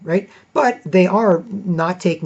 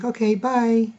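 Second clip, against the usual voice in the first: lightly voiced sweet thanks, a bit higher; sweet woman's voice; 7 semitones higher, slower than usual. Retelling the customer service woman's thanks, lightly sweet. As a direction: imitate her sweetened voice. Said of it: "very sweet".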